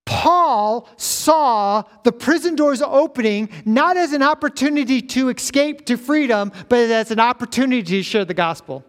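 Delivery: high, emphatic pulpit proclamation; ringing sermon voice, easing at the end; impassioned preaching. Real, not acted. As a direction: drive the point home to the congregation. Preaches at full force.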